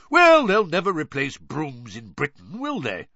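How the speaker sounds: high, loud and breezy; hearty sporty bloke; jovial Bagman. Acted.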